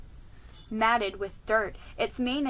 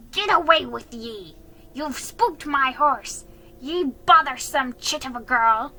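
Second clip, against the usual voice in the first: loud, raised scolding; angry yell; 4 semitones higher, 7 dB louder than usual. A narrator's reading. Jiggy blaming Felicity for the spooked horse, yelling. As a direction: chase the child off with a yell. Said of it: "yelled at Felicity".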